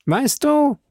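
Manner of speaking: high-pitched blustering musketeer; Porthos. Acted. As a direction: pitch it well up, mildly reproachful. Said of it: "sprach Porthos". (hohe, polternde Musketierstimme)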